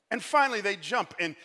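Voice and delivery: ordinary talk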